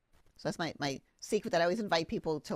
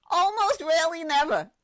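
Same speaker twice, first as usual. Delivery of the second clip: high, loud disbelief at the phrase; outraged echo of the doctor; incredulous repeat; 11 semitones higher, 9 dB louder than usual. Real, not acted. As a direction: throw the words back in disbelief. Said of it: "repeating doctor's words in disbelief".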